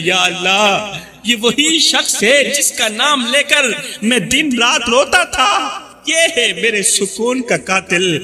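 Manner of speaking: ordinary delivery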